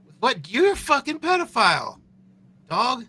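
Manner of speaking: real indignant shouting; outraged yell. Real, not acted. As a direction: shout him down in disbelief. Outraged reaction to streamer clip.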